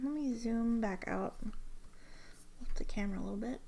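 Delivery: quick, soft whisper to herself; whispered aside. Real, not acted. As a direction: mutter it under your breath while fiddling. Aside while adjusting camera.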